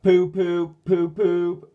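loud high poop poop horn; tooting horn. A narrator's reading. Diesel toots his horn to signal ready. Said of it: "tooted seven one oh one".

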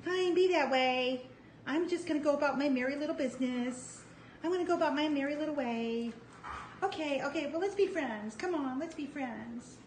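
high, sing-song voicing of the puppy's thoughts; chirpy high dog voice, playfully pleading. Puppy voiced talking to the other dog, high and coaxing. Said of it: "She's like".

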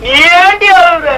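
crying. Acted. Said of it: "நீ ஏன் அழுகுற?".

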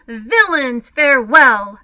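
loud, howling cry; howled farewell. A narrator's reading. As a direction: bellow it as you flee. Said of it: "howled Patrick Edward".

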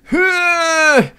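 high burst of giggling; squealing laugh. Real, not acted. Player reaching the boat lets out a squealing laugh. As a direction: squeal with relief.

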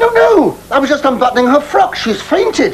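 high, flustered excuse-making; panicked protest. Acted. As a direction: protest your innocence in a high, rushed panic. Caught undressing woman, protests.

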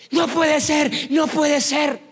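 high-pitched acted exclamation of amazement; astonished disbelief. Healed man seeing his hand, high astonished exclamation. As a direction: cry out as if you cannot believe it.

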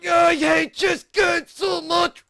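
high, slow, screamed words; furious scream. Real, not acted. Player hating the game, slow furious scream. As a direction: scream every word in rage.

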